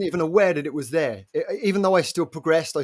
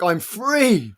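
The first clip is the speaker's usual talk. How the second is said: relived joy, pitched higher; retold cry of relief; joyful relief; 5 semitones higher than usual. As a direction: let the joy out on a stretched last word. Retells own joyful realisation.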